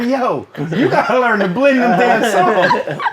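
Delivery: loud, high laughing jab; laughing tease. Retold or imitated. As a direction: tease him through laughter. Mocking quote while laughing.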